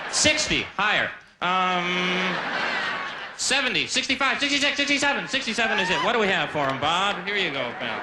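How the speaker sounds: game show host counting up prices. Retold or imitated. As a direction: call the numbers like a quiz host. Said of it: "like a game show".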